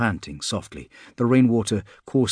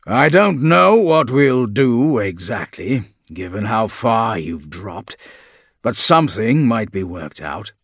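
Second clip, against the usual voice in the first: monster voice; 5 dB louder, slower than usual. A narrator's reading. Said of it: "Philemon says".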